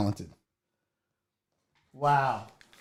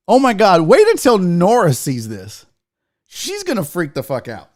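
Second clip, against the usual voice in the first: usual speaking voice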